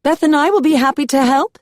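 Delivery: very high, loud Southern Belle; Southern Belle accent. A narrator's reading. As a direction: lay on the Southern Belle to please. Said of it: "in her best Southern Belle accent".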